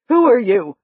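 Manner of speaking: loud sharp question; demanding. A narrator's reading. Frightened woman demanding who Doc is, loud. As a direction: demand an answer. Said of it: "she demanded".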